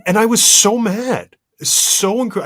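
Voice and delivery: loud and high, voice rising with anger; real anger. Real, not acted. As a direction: let the frustration burst out. Angrily retelling ruined Super Bowl.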